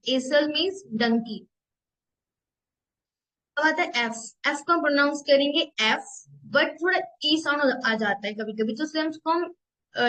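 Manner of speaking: ordinary talk, nothing marked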